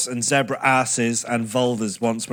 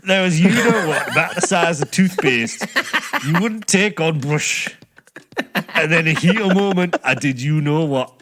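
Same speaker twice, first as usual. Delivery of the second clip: hammed-up Yorkshire recital; Yorkshire accent; 2 semitones higher than usual. Shown as a demonstration. Reading the confession to laughing co-hosts, Yorkshire accent. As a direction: read it out in a put-on Yorkshire accent. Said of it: "in a Yorkshire accent for me".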